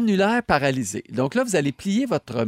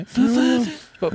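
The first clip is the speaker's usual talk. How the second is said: speaking on inhale; 9 semitones higher, 4 dB louder than usual. Shown as a demonstration. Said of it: "essayez d'inspirer par le nez quand vous êtes en train de parler".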